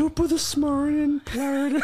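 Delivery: high-pitched botched Irish; mock a terrible Irish greeting; mangled mock Irish. Co-host parodies her before her retry, high mangled Irish. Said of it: "criticizing your Irish accent".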